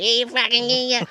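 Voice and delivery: high, breathy-sounding fan gush, mocking; mock swooning. Mocking his audience's swooning.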